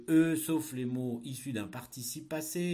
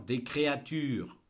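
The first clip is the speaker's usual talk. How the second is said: dictating; 2 semitones higher, 6 dB louder, slower than usual. Shown as a demonstration. Teacher dictating a text.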